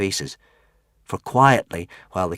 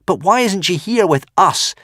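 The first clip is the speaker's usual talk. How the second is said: put-on high, eager little boy asking; a five-year-old pleading question; little boy asking his father about mother; small child's voice; 11 semitones higher, 8 dB louder than usual. Little Hiccup asks.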